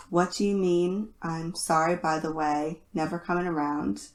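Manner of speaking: ordinary delivery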